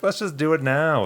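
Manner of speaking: high and pushy; insisting eagerly. Retold or imitated. Young man insisting to his friend they talk now, high and eager. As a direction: push to do it right away, keenly. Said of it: "I was like".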